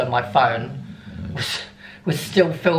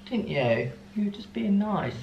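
gentle, quiet words to a pet; murmuring to the dog after the walk; soft talk to the dog; 4 semitones higher, 8 dB quieter than usual. Real, not acted. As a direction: coo softly to your dog. Talking softly to her dog.

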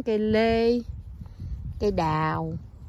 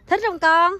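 calling out; 9 semitones higher, 9 dB louder, slower than usual. Real, not acted. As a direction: call across the field to her. Calling out to child.

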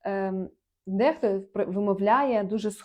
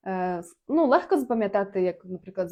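usual speaking voice

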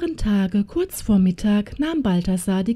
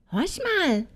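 high-pitched eager witch's call; excited call; 9 semitones higher, 3 dB quieter than usual. A narrator's reading. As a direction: call out high, urging him to listen. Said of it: "rief sie dann".

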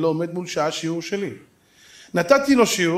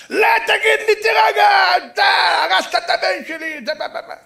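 high, loud mock rant breaking into babble; furious shouting; 7 dB louder than usual. Father accuses speaker of ruining his son, mock furious shouting. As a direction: yell over the other person, hammed up.

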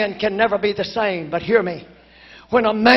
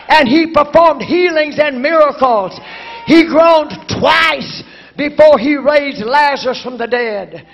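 fervent preaching; 3 semitones higher, 5 dB louder than usual. Acted. Preacher praising Moses and Jesus as men of prayer, rising preaching. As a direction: lift the congregation with each name.

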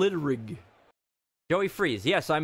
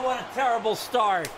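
usual speaking voice

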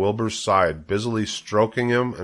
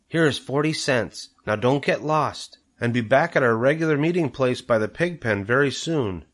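higher-pitched, careful maternal voice; mother instructing; 5 semitones higher than usual. A narrator's reading. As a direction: give a child firm parting instructions. Mother gives money with warnings.

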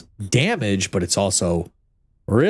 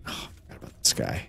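quick hushed whisper; 13 semitones lower, 12 dB quieter, much faster than usual. Real, not acted. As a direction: breathe it out fast, unvoiced. Enemy suddenly appears.

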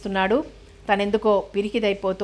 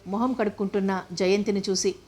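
ordinary delivery, nothing marked

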